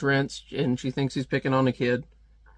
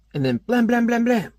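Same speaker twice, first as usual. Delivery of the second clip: higher, louder blam blam gunfire; gunshot sound effects; 9 semitones higher, 3 dB louder than usual. Retold or imitated. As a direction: fire off mock shots with your voice. Retelling a comic gunfight.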